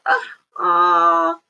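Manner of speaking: teasing hesitation; drawn-out ah before the reveal; suspenseful gasp. Acted.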